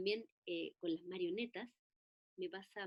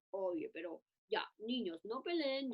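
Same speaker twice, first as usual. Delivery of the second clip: mother scolding. Retold or imitated. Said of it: "llegó la mamá".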